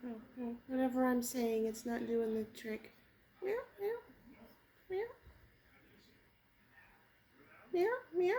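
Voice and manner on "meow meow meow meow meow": high imitated meow; mock cat meow. Meowing to lure cat.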